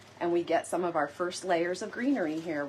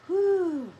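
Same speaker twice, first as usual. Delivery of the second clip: excited whoop; 11 semitones higher, 4 dB louder than usual. Real, not acted. Excited while adding feathers.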